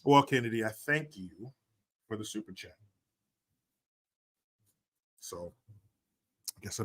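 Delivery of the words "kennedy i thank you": ordinary talk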